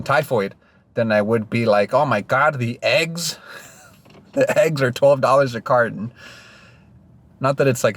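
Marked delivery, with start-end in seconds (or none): 1.9-6.1 high, whiny mock complaint; whiny complainer. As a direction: overdramatic moaning about prices. Mock complaint about egg prices.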